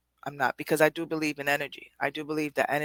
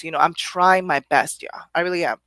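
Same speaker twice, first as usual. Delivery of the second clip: ordinary talk, nothing marked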